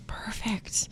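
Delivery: hushed, slow, in wonder; awed whisper. Real, not acted. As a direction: whisper it in awe. Awed at fan recreations.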